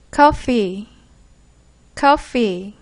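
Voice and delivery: 커피 said as English coffee, with an f; English pronunciation. Shown as a demonstration. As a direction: model 커피 as the English word, twice. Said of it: "개프쌤의 발음을 듣기 전에". (커피를 영어식으로 시범 발음)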